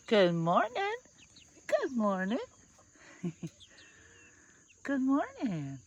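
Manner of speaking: high, loud sing-song good morning; sing-song greeting. Real, not acted. Farmer greeting her hens, high sing-song.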